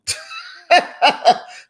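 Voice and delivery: breathy burst of laughter; high airy laugh. Real, not acted. Laughing at telling Todd to stay away. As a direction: cackle at banishing Todd.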